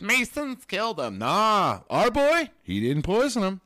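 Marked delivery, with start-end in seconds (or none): 0.0-1.2 mocking high voice. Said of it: "conspiracy theorists that said". 1.2-2.5 high loud proud cry; excited cheer. Real, not acted. Cheering for Rasputin.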